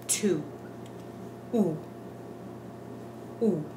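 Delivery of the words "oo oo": U as a pure "oo", repeated after a pause; Spanish U vowel. Shown as a demonstration. Teacher demonstrating the Spanish vowel, U said as "oo". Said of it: "like the way we pronounce in the word".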